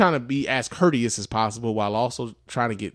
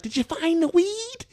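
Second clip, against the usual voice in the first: much higher, slow sly granny voice; old lady voice; slower than usual. Retold or imitated. Old lady checking whether the worker found her weed, high slow mock voice. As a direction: ask it like a sneaky old lady. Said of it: "She's like".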